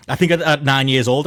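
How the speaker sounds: ordinary talk, nothing marked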